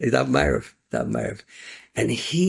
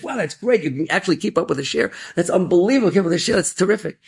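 high, lively astonished praise; amazed praise; 9 semitones higher than usual. Acted. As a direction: heap on the wonder. Rebbe amazed at progress.

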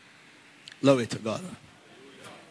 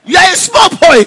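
high, loud, furious scolding; the Reverend Father snaps at the boy; angry priest; 10 dB louder than usual. Retold or imitated. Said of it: "he got angry".

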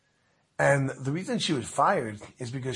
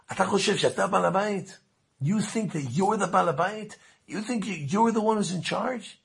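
high-pitched retold challenge; incredulous indignation; 7 semitones higher than usual. Visitor challenging who is in charge, indignantly incredulous. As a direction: question it in high, acted disbelief.

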